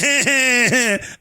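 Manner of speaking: hammed-up fake wailing; exaggerated pretend bawling; mock boo-hoo crying. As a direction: imitate crybabies with drawn-out sobs. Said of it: "they're all boo-hooing and crying".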